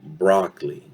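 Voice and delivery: BROCCOLI as BROCK-lee; two-syllable BROCCOLI ending the sentence; reduced vowel. Shown as a demonstration. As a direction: drop the O from BROCCOLI. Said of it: "So there it is. Reduction".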